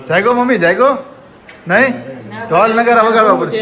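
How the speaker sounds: high, loud playful calling; wake-up call. Real, not acted. Telling a sleeper to wake up, loud high call.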